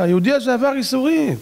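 retold advocate interrupting the court; angel's plea. Angel pleads in heavenly court.

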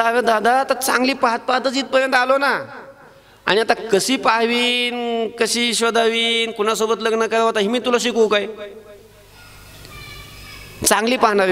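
put-on a knowing little boy answering his big brother; child Krishna replying. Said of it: "कृष्णाने सांगितलं".

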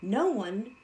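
usual speaking voice

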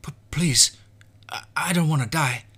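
high, loud desperate plea; pleading. Acted. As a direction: beg for your life. Boy begs for life.

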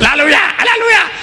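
high, rapturous mock cries of hallelujah; mimicking congregation. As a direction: squeal it out like an excited worshipper. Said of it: "they said".

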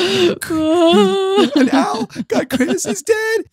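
very high, squeaky mock sobbing; imitated distraught wail. Podcaster imitating partner breaking the news of Chris, mock wail. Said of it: "you were not okay".

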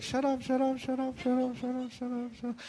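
high, soft, rapid-fire pleading chant; squeaky little voice inside the head; irritated inner voice. Acted. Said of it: "In your head, you're like".

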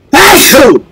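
a full, loud sneeze that bursts out; loud real sneeze. Real, not acted. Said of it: "I'm sneezing!".

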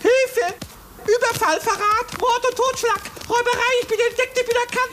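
high, rushed alarm, tumbling over the words; panicked cry for help. Acted. Scared hare crying out to the girl, panicked and high. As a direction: yell it all out in a frightened flurry.

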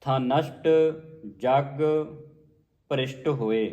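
word by word, resting after ਹੋਏ; pause on ਹੋਏ. Shown as a demonstration. Teacher showing where to rest, pausing after ਹੋਏ. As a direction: split each word and stop at ਹੋਏ. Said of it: "ਹੋਏ ਤੇ ਵਿਸਰਾਮ ਹੈ".

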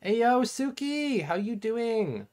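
high, slow sing-out hello; cheery drawn-out greeting. Real, not acted. Streamer greeting a returning viewer, high drawn-out cheer.

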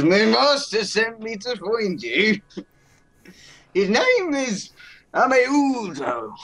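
high, slow, grand giant voice; giant's booming voice. Acted. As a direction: proclaim it slowly and high. Giant character speaks.

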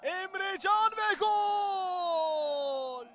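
drawn-out goal scream; screaming football commentator. Real, not acted. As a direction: stretch the goal call. Goal call.

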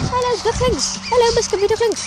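sing-song greeting pitched well up. Real, not acted. Greeting the ducklings in a high cooing voice. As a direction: coo hello to the little ones up high.

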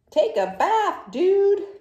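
high, loud buzzy fly voice; fly telling the pigeon to take a bath; fed-up fly. Acted. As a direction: shoo the pigeon off as a grossed-out fly. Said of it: "that one is saying".

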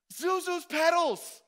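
loud, high, slow delighted cry; joyful shout again. Retold or imitated. George repeats the petals line, overjoyed loud cry. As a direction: call it out overjoyed. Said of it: "he goes".

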